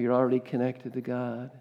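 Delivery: ordinary talk, nothing marked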